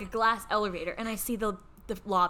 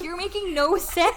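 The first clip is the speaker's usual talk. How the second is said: imitated indignant complaint, pitched up; higher, put-out tone, as if the other is stupid; dismissive; 6 semitones higher, 3 dB louder than usual. Friend treating her like the stupid one, mock indignant. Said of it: "like I was the stupid one".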